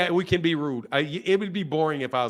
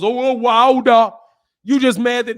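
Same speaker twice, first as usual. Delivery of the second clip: mocking heckler; 7 semitones higher, 10 dB louder than usual. Imitating bozos who taunt Wilder fans, loud mocking jeer. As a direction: jeer it loudly, imitating a bozo.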